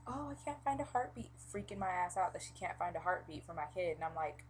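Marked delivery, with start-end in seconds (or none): 0.1-1.3 mimicking the midwife. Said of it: "she was like".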